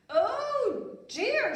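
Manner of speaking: high, loud dismayed queen. Acted. Queen realises, high loud dismay. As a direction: sigh it out royally. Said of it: "said the queen".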